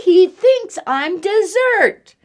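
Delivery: put-on high, loud panicked child; frightened boy. Eddie telling Mom about the bear, high fright. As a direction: blurt it out in fear.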